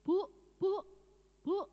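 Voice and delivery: high, loud, anxious calling; urgent repeated call of Bu. Retold or imitated. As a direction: try to wake her up by calling. Husband calling to wake her.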